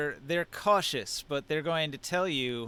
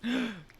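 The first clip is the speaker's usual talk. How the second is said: sharp gasp; 8 semitones higher than usual. Acted. GM reacts to zombie news.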